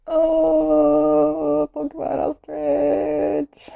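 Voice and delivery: high, slow, amazed porcupine ostrich; gasping delight. Shown as a demonstration. Announcing the next drawing to viewers with gasping excitement. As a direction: savour porcupine ostrich like a marvel.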